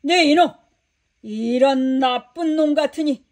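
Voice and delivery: a furious lord scolding his servant; thundering rebuke. Acted. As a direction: master bellowing at the ungrateful servant. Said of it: "크게 호통을 쳤지".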